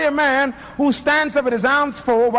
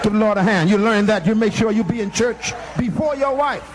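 ordinary talk, nothing marked

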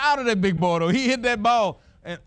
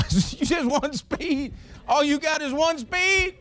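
teasing; 8 semitones higher, slower than usual. Retold or imitated. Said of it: "I said".

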